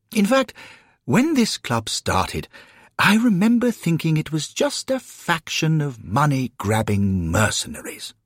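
high, hesitant, fussy gentleman's voice; apologetic gentleman newcomer. Acted. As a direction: politely explain the mistake, pitched high. Newcomer explains the mistake.

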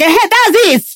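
high-pitched fan cheer; imitated delight. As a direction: mimic a thrilled fan getting what they wanted. Acts fans delighted by the voice.

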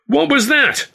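high furious shout; angry shout. A narrator's reading. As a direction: yell it the moment they walk in. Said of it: "Ed shouted as soon as".

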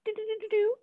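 high sing-song "do-do-do"; mock calculating noise. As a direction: rattle off high little counting noises. Mimics computing an arbitrary number.

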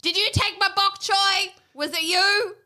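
playful mock interrogator voice; mock interrogation. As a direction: grill the possum, hammed-up. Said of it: "interrogate it with a torch".

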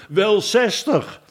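high, slow, drawn-out answer; mumbling minister. A narrator's reading. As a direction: stretch it out as a drowsy mumble. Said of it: "de andere mompelde".

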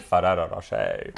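"Ferrero Rocher" low and soft, tapped d-like r's; Euro pronunciation. Shown as a demonstration. Showing the Netherlands way, "Ferrero Rocher" in low Euro pronunciation. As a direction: say "Ferrero Rocher" as Fa-dadda Ro-shay, pitched low. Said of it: "a Euro way of saying it".

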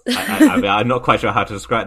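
ordinary talk, nothing marked

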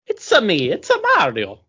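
bouncy mock Italian plumber; Mario impression. Asked for dramatic Italian, doing Mario Italian. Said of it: "Mario Italian".

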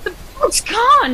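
high, slow, panicked wail; panicked cry. Acted. Boat has vanished, panic.

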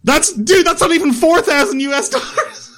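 gleeful mockery through laughter; high laughing taunt; laughing tease. Real, not acted. Teasing over exchange rate.

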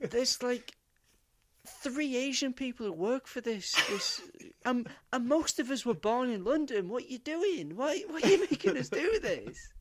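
high, pleading mock exasperation; acted incredulous employee, voice pitched high; exasperated protest. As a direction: plead upward, baffled by the boss's orders. Acted employee protesting the absurd plan.

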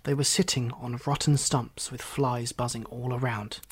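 usual speaking voice

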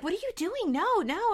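high-pitched alarm, acted out. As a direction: imitate someone trying to stop a friend. Retold alarm at the pills.

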